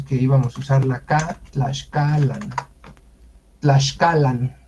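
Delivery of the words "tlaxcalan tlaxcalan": Tlaxcalan said "tlash-CA-lan"; correct Nahuatl pronunciation. Shown as a demonstration. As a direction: repeat "Tlaxcalan" carefully with sh. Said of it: "ese se pronuncia".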